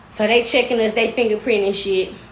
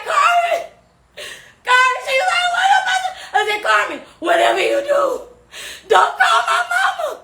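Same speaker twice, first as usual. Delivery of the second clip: much higher, loud frantic calls; frantic yelling; 3 dB louder than usual. Retold or imitated. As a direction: yell after her in a panic. Yelling to friend.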